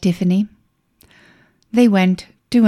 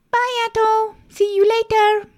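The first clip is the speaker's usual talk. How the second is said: put-on little girl voice. Girl says goodbye to cat.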